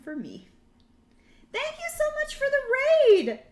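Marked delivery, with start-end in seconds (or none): 1.5-3.4 high, loud, bright thank you; excited thanks. Real, not acted. Streamer thanking incoming raiders, excited and loud. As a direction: squeal it out to the raiders.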